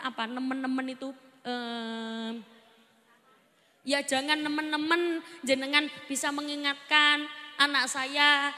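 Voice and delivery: ordinary talk, nothing marked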